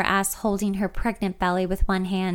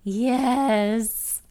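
put-on drawn-out, childish whiny yes; kid-like whine; 3 semitones higher than usual. A narrator's reading. Answering her friend about the dinner party, whining like a kid. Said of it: "in a kind of whine like a kid might to their mom".

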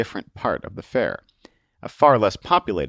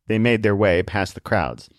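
ordinary delivery

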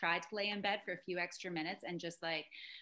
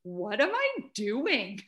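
slow, louder mock disbelief; imitated bafflement; 3 semitones higher, 6 dB louder, slower than usual. Wife imitates husband shocked at himself, mock bafflement. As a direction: stretch out a retold question, playfully baffled.

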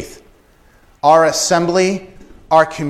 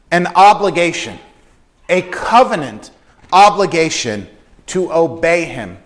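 ordinary delivery

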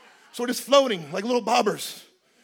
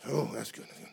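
low murmured approval; 10 semitones lower, 13 dB quieter than usual. Retold or imitated. Quiet aside.

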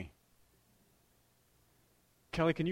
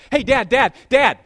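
loud, higher repeated calls; kid calling for dad; 8 semitones higher, 15 dB louder than usual. Retold or imitated. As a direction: shout to get dad to look up. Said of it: "she goes".

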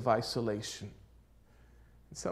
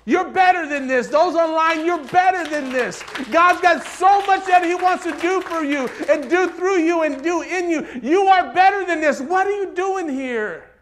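loud, high-pitched exhortation; full-force preaching; 14 semitones higher, 15 dB louder than usual. Real, not acted. Preacher urges those online that they're better, full-force preaching. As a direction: preach it at full volume, urging them on.

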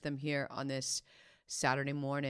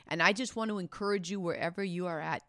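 ordinary delivery

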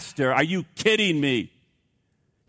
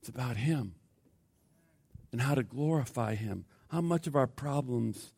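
low, very soft after the climax; hushed preaching; 4 semitones lower, 10 dB quieter than usual. Real, not acted. Preacher pointing the congregation to glorifying him, hushed. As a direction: let it fall to a quiet, weighty near-murmur.

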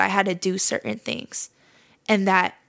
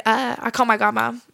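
ordinary talk, nothing marked

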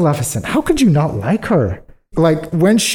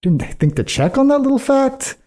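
much higher, incredulous jab at the husband; mock exasperated queen; 12 semitones higher than usual. Queen scolding the king for not checking, much higher and incredulous. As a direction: needle him with disbelief. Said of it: "And the queen was like".

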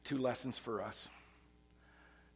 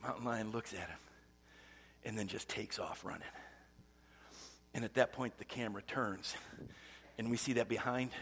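ordinary talk

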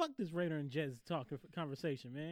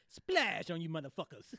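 loud Russian caricature with a drawn-out shout; mock Russian accent; 3 semitones higher, 7 dB louder than usual. Retelling a shot celebration, mock Russian accent. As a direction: crow it in an ugly Russian accent. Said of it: "ugliest, this, um, Russian voice".

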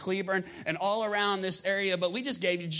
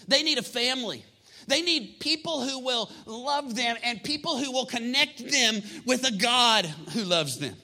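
loud, raised sermon voice; impassioned preaching; 5 semitones higher, 4 dB louder than usual. Real, not acted. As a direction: preach it forcefully, stretching the key words. Preacher drives home the need.

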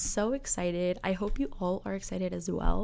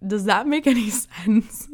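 laughing; 2 semitones higher, 6 dB louder than usual. Real, not acted. Laughs at own cheesy line.

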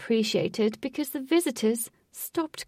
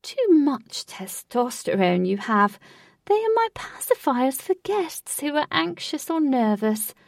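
echoing voice; 6 semitones higher, 4 dB louder than usual. Acted. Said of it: "echoed Lutz's voice".

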